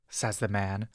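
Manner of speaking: ordinary delivery, nothing marked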